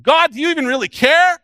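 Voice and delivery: loud, high plea climbing at the end; exasperated cry to God. Acted. Doubting believer asking whether God cares, crying out in frustration. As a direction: demand an answer from heaven.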